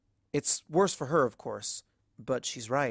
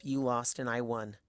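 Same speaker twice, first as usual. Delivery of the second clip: ordinary delivery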